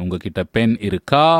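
closed question with "இருக்கா" lifted on its last vowel; inflected question. Shown as a demonstration. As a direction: push up on the ending "kaa" of "இருக்கா". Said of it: "there is an inflection in closed question".

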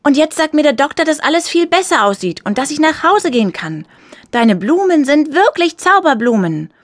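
put-on high, loud, overjoyed boy; beaming excitement. A narrator's reading. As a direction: call out good news with a beaming smile. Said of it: "sagte er mit einem strahlenden Lächeln".

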